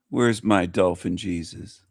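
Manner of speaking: ordinary talk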